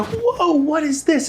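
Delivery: a startled yelp at the first sip; shocked surprise. Pretend. Newcomer tasting unexpected sweet tea, startled and high. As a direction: jump way up in pitch on whoa, startled.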